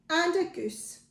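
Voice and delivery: plain reading voice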